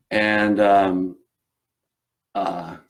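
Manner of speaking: ordinary talk, nothing marked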